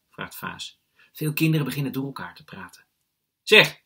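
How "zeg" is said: loud, high attention call. A narrator's reading. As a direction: shout to cut through the chatter. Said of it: "roep ik".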